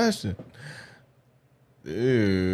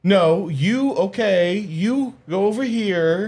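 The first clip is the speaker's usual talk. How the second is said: higher, slow imitation of a man getting mad; James impression; 9 semitones higher, slower than usual. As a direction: mimic him telling someone where to go, high and slow. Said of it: "It reminded me of James".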